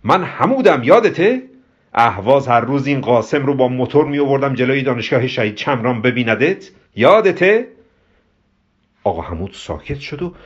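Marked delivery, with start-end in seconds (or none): 0.0-7.7 high, booming phone greeting; loud voice. Acted. Hamud reminds Diana's mother on the phone, loudly. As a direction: shout excitedly to jog their memory. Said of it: "با صدای بلندی گفت".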